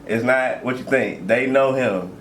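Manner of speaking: normal talking voice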